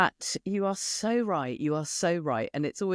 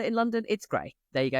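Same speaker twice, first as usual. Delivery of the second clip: ordinary talk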